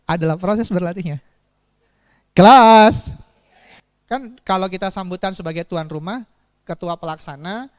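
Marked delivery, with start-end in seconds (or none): 2.3-3.1 loud call for attention; calling the class. Real, not acted. Teacher calling out loudly to get the class's attention. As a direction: call out to the room.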